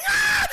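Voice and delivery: very high, loud shriek; hammed-up shriek of terror; imitated scream. Wife with scorpion crawling on her, imitated scream.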